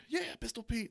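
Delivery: put-on high cheering kid's voice; excited kid. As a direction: cheer like a thrilled child showing mom. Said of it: "they're like".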